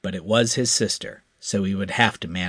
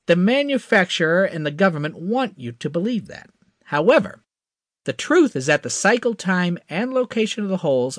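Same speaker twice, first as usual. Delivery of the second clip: higher, confident hotshot; eager young pilot; 8 semitones higher than usual. A narrator's reading. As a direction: promise it with cocky eagerness. Crash explaining, higher.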